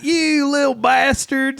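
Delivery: high, drawn-out teasing insult; imitated Texas guys. Guys from Texas talking to George, drawled mock insult.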